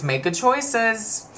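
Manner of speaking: high, slow, teasing parting line; friend's parting line. Retold or imitated. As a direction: drawl "Make good choices" as you walk out. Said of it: "she turns around and she goes".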